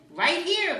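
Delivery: high, loud eager call; eager cry. Acted. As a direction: cry it out with excitement. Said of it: "cried Max".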